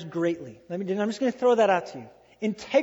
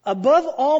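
louder, higher call on Above all; ringing proclamation; 6 semitones higher, 9 dB louder than usual. Shown as a demonstration. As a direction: announce it as the big reveal. Revealing James' words.

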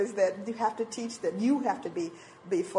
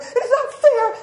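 very high, loud mock tantrum; hammed-up childish complaint; whining protest; 11 dB louder than usual. Mother driving to work jealous, wailing in protest.

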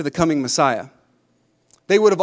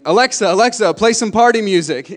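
imitating kids yelling at Alexa; raised, higher mock kid yell; 3 semitones higher, 4 dB louder than usual. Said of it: "just yelling".

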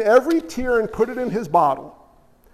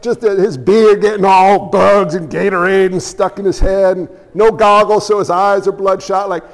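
ordinary talk, nothing marked

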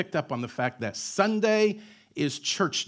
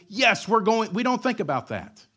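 loud, high mock exasperation; exasperated father's answer; 7 semitones higher, 4 dB louder than usual. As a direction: answer the child as if it's obvious. Father answering absurd question.

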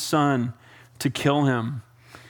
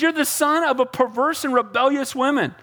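high-pitched mock scolding; mildly acted anger; 8 semitones higher than usual. Saul mad at his son Jonathan, mock angry. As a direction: scold with a touch of heat. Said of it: "he's getting mad at his son".

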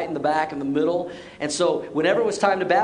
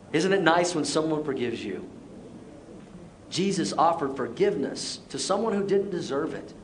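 ordinary talk, nothing marked

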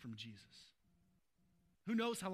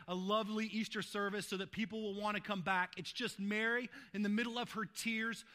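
loud, high, driving sermon voice; full-force preaching; 4 semitones higher, 5 dB louder than usual. Acted. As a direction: preach it out to the back row. Preaching build.

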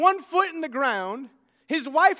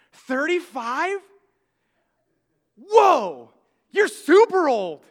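put-on slow, louder kid gasp of disbelief; shocked kid; 3 semitones higher, 8 dB louder, slower than usual. Girl marvels at the preacher's age, loud and slow. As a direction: gasp at how old he is.

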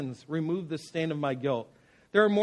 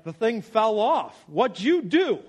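uncle scolding; 5 semitones higher, 6 dB louder, slower than usual. Retold or imitated. Uncle scolding at the gas station.

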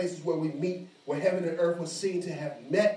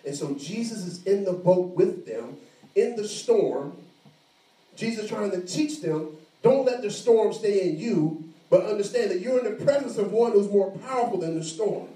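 preaching with force; 5 semitones higher, 5 dB louder than usual. Acted. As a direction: drive the point home to the congregation. Preacher builds to full force.

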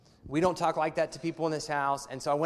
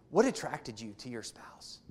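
gentle question; 6 semitones lower, 6 dB quieter than usual. Pretend.

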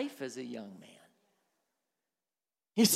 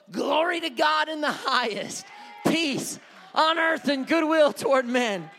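higher, ringing praise to God; proclaimed praise; 5 semitones higher, 6 dB louder than usual. Pretend. Preacher praising God despite hardship, proclaiming it loudly. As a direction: proclaim it out to heaven.

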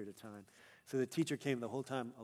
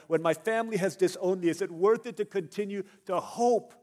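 loud, raised, driving sermon delivery; impassioned preaching; 6 semitones higher, 11 dB louder than usual. Acted. Struggling believer asking if faith is worth it, impassioned preaching. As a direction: build a pleading question at full pulpit force.

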